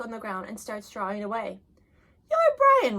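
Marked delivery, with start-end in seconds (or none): 2.3-3.0 much higher, brighter opening; high, eager hello; friendly greeting. Acted. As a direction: reach out to the shy boy. New boy greets Brian.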